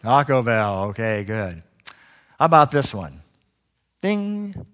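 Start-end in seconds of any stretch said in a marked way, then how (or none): 4.0-4.7 high sung-out ding; imitated chime. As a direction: mimic an airline cabin chime. Imitating the airline commercial's chime.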